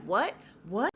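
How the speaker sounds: mock-stunned, sharply rising questions; retold disbelief. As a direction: pitch each one up in disbelief. Retelling a stunned father's reaction.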